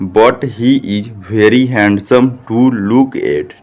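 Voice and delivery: each English word drawn out separately; slow model reading. Shown as a demonstration. Teacher models the long sentence for pupils, slow model reading. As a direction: read it out slowly, word by word. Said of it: "तो आप लोग कैसे पढ़िएगा?".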